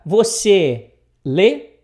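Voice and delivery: loud, slow, spaced-out question; word-for-word gloss. Shown as a demonstration. Teacher glossing "do" as a question marker, loud and slow. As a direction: announce it, then draw out the question. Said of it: "o que que ele quer dizer aqui".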